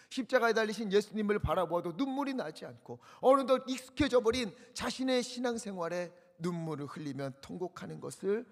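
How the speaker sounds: usual speaking voice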